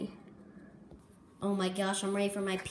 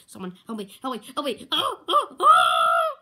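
rising, louder pleas ending in a wail; panicked cry for help; 9 dB louder than usual. Acted. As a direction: beg for help stuck in bed. Stuck in bed, panicking.

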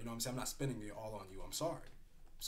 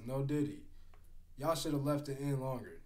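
normal talking voice

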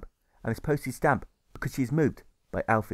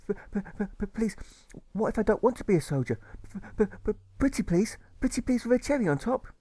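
put-on stammering boy; 11 semitones higher than usual. Alfred pleads.

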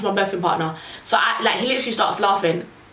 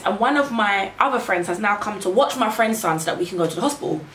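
ordinary delivery

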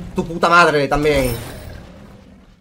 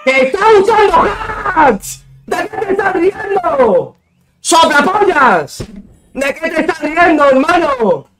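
furious yelling; 9 semitones higher than usual. Real, not acted. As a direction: scream in real outrage at a cheater. Enfurecido con un rival tramposo. (gritos de furia, muy agudos)

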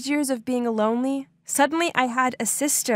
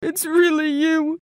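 tearful; 5 semitones higher than usual. Retold or imitated. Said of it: "he started crying and hugging me".

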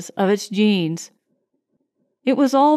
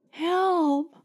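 soft, high, feeble plea; faint cry; 7 semitones higher, 5 dB quieter than usual. A narrator's reading. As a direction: plead faintly, as if too weak to call. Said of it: "she whispered faintly".

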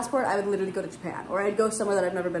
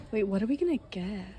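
ordinary delivery

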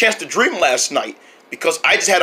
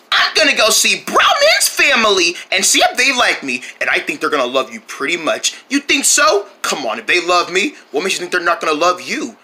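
ordinary delivery

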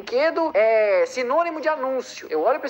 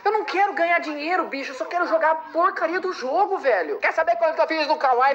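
exasperated; 6 semitones higher than usual. Real, not acted. Yelling back at the pop-up ad.

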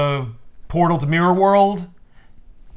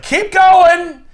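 high, loud urging yell; greedy shout; 8 dB louder than usual. Retold or imitated. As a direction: shout him on, greedy and pushy. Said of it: "And Snart's like".

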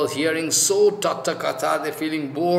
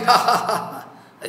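higher-pitched mock laugh, ha ha ha; imitated laughter; 4 semitones higher than usual. Gopis laughing at Krishna's advice, imitated laughter. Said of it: "They are laughing".

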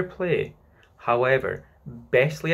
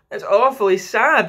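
put-on child twin; 8 semitones higher, 9 dB louder than usual. A narrator's reading. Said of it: "the first twin said".

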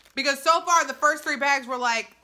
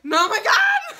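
high, loud, laughing outburst; excited shriek; 9 dB louder, slower than usual. Real, not acted. Reviewer giddy over dill pickle popcorn, excited shriek. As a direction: squeal it out, breaking into laughter. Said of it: "I'm getting so excited".

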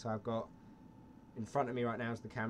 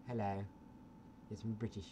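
put-on British accent; 4 semitones lower, 7 dB quieter than usual. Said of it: "stereotypical British".

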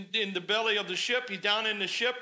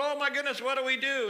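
higher-pitched mock panic; panicking sailors; 6 semitones higher, faster than usual. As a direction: lightly imitate sailors at a loss.

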